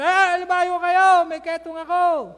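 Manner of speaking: slow, loud, high crying out; leper's warning shout. Acted. Leper warning people to keep away, loud shout. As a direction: call the warning from far away. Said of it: "sumisigaw ka na".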